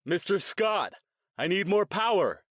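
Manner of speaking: loud, high mock captain's command. Jason playing captain, calling down to the engine room, loudly. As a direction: call out urgently as if to the engine room. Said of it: "He pitched his voice louder".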